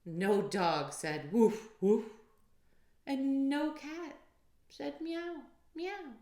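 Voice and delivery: usual speaking voice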